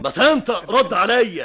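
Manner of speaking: loud, high, impatient order; angry demand. Acted. Boss demanding an answer from the helper, loud and angry. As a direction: bark at him to speak up.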